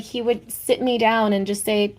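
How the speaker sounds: normal talking voice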